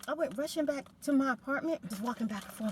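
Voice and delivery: ordinary delivery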